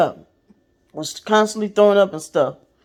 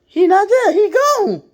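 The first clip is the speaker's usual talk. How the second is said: much higher, startled mock squeal; acted disbelief; 12 semitones higher, 3 dB louder than usual. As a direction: blurt it in high-pitched shock. Acting out finding husband gone.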